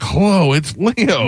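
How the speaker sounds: put-on high, old man's hello; Uncle Leo voice. Host voicing soundboard uncle calling an old lady. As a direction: imitate the uncle calling a relative. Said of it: "he's like".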